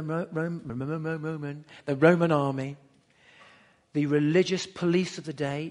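normal talking voice

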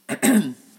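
sharp loud throat clear. Real, not acted. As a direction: clear your throat loudly.

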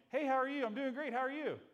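high, loud, bright Sunday-morning greeting; chirpy churchgoer putting on a show; cheery greeting. Retold or imitated. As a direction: sing out a fake-cheerful hello. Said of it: "you walk in".

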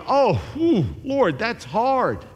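high, slow weary sigh; mock-dismayed "whew", slowed down; sighing listener. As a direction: groan it out to the Lord. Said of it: "you're going".

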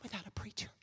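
near-whispered preaching aside; hushed question. Acted. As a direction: drop to a whisper for effect.

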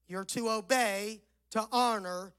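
normal talking voice

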